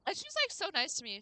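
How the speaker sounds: ordinary talk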